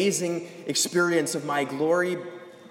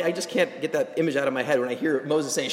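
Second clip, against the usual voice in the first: usual speaking voice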